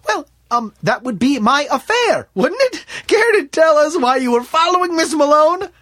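very high, loud challenge; sharp questioning. A narrator's reading. Smith demanding why David followed Miss Malone, sharply. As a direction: turn it back on him pointedly. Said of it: "asked Smith with a sharp look".

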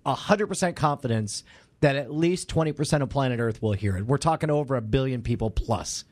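low-pitched, grand announcer promise; low, pompous ad-man assurance; mock-solemn sponsor pledge. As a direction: deliver an absurd guarantee in a deep, official voice. Mock guarantee during sponsor read.

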